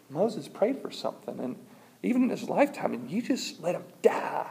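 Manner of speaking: hushed incredulous objection; mock protest. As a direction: protest in a small, pained voice. Preacher protests to God, retold.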